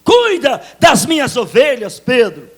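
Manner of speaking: loud, high shouted charge; Jesus shouting command. Acted. Jesus shouting at Peter to tend his sheep. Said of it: "disse Jesus".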